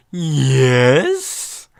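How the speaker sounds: an odd sing-song voice answers from upstairs; eerie yes voice. Acted. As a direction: call down a strange, drawn-out yes. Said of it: "Just this voice I'm doing for the yeses".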